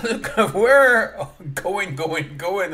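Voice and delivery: normal talking voice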